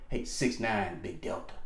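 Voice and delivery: slow, low, soft tail-number call; crisp radio check. Shown as a demonstration. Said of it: "give her your tail number".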